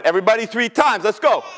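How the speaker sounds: ordinary delivery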